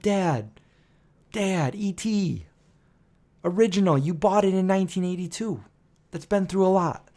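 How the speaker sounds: mildly acted exasperated appeal, with pauses; retold reproach. Acted reproach to dad over mask.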